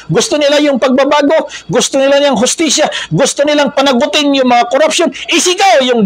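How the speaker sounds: impassioned preaching. Real, not acted. Preacher lists what protesters want, impassioned preaching. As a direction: pile up the demands in a high preacher's voice.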